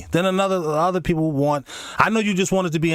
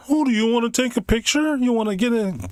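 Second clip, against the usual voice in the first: high sugary nurse voice; mock nurse, syrupy and high; 10 semitones higher than usual. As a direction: imitate the nurse, sweet and much higher. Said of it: "the lady said".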